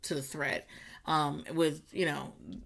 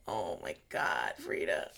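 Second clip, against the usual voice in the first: choked up; 11 dB quieter than usual. Real, not acted. Moved by [name]'s story.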